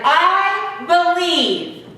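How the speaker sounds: long, drawn-out high shout; joyful shout. Acted. Boy finally believing in Santa, joyful drawn-out shout. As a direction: shout it out slowly with all your joy. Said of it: "he shouted".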